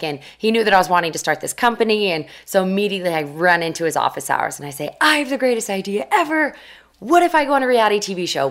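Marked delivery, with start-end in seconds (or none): 5.0-8.5 higher-pitched, mildly hammed-up excitement; eager pitch. Student pitching her big idea to her professor, eager.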